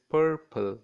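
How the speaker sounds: "purple" as pur, brief gap, pull; two separate beats in "purple"; syllable by syllable. Shown as a demonstration. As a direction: split "purple" between R and P. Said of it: "put a slash in between them".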